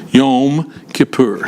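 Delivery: Yom Kippur slowly, long O in Yom and long U in Kippur; Hebrew Yom Kippur. Shown as a demonstration. Teacher drilling the class on Hebrew Yom Kippur, slowly. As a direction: sound out Yom Kippur syllable by syllable, slow. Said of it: "long O in Hebrew".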